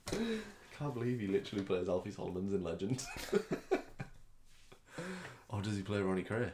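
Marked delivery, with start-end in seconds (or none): none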